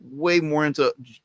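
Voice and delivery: usual speaking voice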